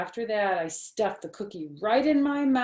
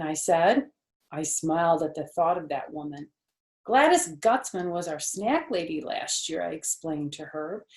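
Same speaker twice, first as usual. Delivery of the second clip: plain reading voice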